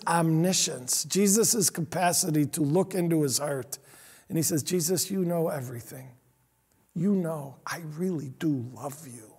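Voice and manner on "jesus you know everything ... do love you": hushed. Acted. Peter's plea, retold softly.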